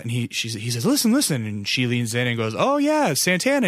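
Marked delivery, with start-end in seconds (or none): 0.9-1.4 high-pitched imitated plea to listen; insistent, thrilled mimicry; excited urging. Dad urging mom to listen. 2.6-3.7 high-pitched imitation of mom agreeing; imitated pleased discovery; excited recognition.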